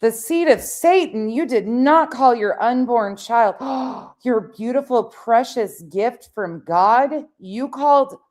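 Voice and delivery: high, loud mock scandalized gasping; mock outrage. Mocks the clip's outrage.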